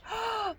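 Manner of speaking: high, sudden intake of breath; storybook gasp of delighted discovery; sharp gasp. Acted. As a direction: gasp as if catching a scent. Bear catches a scent in story.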